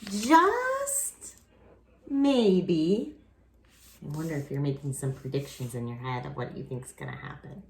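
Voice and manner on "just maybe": just pitched very high and stretched; building suspense. A narrator's reading. Reading the ending to children, just sung high for suspense.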